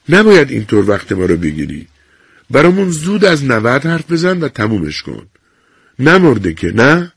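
brisk, mildly impatient nurse; scolding nurse. A narrator's reading. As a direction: hurry her along, a touch sharp.

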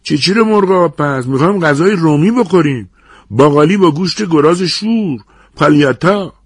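high, loud heckling from a diner; indignant shout. A narrator's reading. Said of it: "یکی داد زد".

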